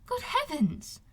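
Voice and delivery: high startled cry of dismay; cried out. Acted. Elizabeth crying out at Wickham's story, high and startled. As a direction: gasp it out, genuinely shocked. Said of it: "cried Elizabeth".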